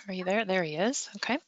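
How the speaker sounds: ordinary delivery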